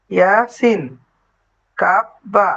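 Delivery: usual speaking voice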